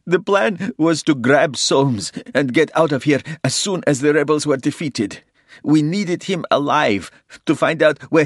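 high, loud, tearful confession; desperate crying plea. A narrator's reading. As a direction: spill it all out, desperate. Captive confesses in tears.

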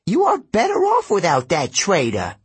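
put-on high, fussy old satyr voice, outraged; a pompous old goat-man scolding; cranky old satyr. A narrator's reading.

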